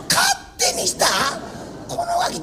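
very high, indignant shout-back; outraged echo. Acted. Husband repeating his wife's words in outrage. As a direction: fling her words back in disbelief.

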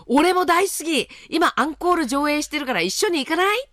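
高く浮かれた夫のせりふ; 声を高く上げてうきうき誘う; 小躍りするはしゃぎ声. Acted. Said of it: "と聞くと小躍りし". (はしゃぐ夫を高い声で演じる)

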